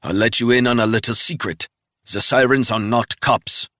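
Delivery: mock him from the ground; sneering captive. Wounded spy taunting Resnick with a sneer.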